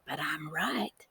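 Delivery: slow unvoiced whisper. A narrator's reading. Brother whispering as he sneaks into the hen house. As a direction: breathe it secretively. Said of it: "he whispered".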